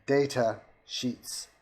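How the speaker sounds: slow, separated words for a machine; slow repeated command. Shown as a demonstration. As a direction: draw out each word as a command.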